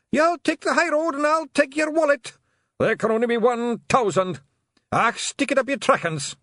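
shrieked high and loud; war cries. Acted. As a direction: scream them over the din.

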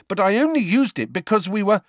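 high, loud angry protest. A narrator's reading. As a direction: protest angrily until cut off. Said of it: "said Harry angrily".

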